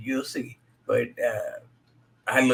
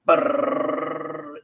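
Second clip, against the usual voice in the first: drum roll; 3 semitones higher than usual. Acted. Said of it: "drum roll".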